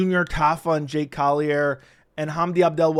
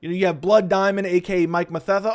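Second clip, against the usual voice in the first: normal talking voice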